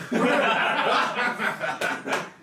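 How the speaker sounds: put-on high, loud, laughing old fisherman; laughing delight. Fisherman takes to his new drinking companions, laughing loudly. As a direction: burst into merry approval through laughter.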